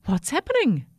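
high, startled little voice; squeaked. A narrator's reading. Toothbrush startled by a ship's horn, squeaking. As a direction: squeak it in fright. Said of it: "he squeaked".

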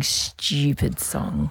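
slow Aussie "styoo-pid song"; Australian accent. Retold or imitated. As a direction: imitate the title in an Australian accent. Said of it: "in an Australian accent".

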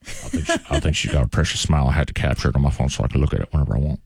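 put-on low, fast creepy old man. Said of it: "great old man voice".

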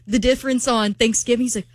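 ordinary talk

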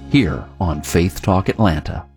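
ordinary delivery

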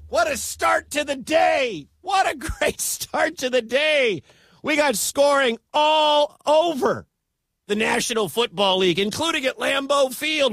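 high shouted delight with a laugh; thrilled call. Real, not acted. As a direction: ride the high of the finish. Excited sign-off after the win.

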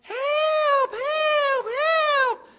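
put-on high, loud kids' cry for help; shouting for help. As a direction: yell it like kids in mock danger. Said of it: "and we started shouting".

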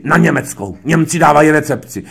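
imitating a loud, brusque director; Krejčík, shouting. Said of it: "Proč na mě křičíte?".

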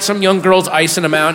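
ordinary delivery

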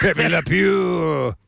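very slow, drawn-out mock French; hammed-up French accent. Radio host riffing on the French joke, hammed-up French accent. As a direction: stretch the name out in cartoon French.

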